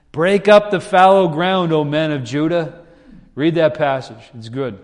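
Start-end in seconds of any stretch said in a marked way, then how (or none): 0.1-2.8 louder, higher proclamation; preacher proclaiming the verse, louder and higher. Shown as a demonstration. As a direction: ring it out like a prophet.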